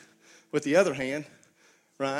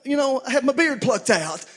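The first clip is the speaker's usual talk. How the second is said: voicing Jesus; 6 semitones higher, 5 dB louder than usual. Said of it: "And Jesus says".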